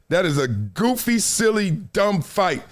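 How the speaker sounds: ordinary talk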